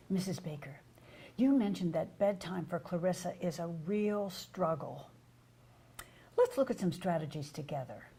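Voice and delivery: calm confidence with gentle pauses; confident caring tone. Shown as a demonstration. Provider tells Missus Baker about bedtime strategies, soft and confident. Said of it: "Could you hear how tone of voice, pause, intonation, and emphasis convey confidence".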